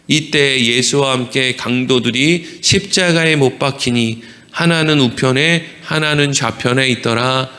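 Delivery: low, measured scripture reading. A narrator's reading. Preacher reads the crucifixion verse to the congregation, measured reading. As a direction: read the verse evenly, pitched low.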